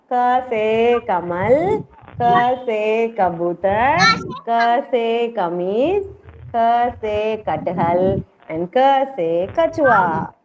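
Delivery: slow, stretched syllables for the class to follow; slow drill. Shown as a demonstration. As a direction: recite each letter and word very deliberately. Teacher models letter-word drill.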